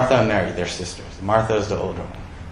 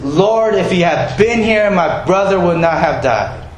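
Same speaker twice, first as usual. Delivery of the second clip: reproachful; 7 semitones higher, 4 dB louder than usual. Retold or imitated. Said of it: "Hard to say that in a nice tone".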